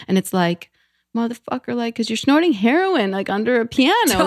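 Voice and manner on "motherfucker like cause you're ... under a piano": exasperated scolding. Retold or imitated. Scolding her past self.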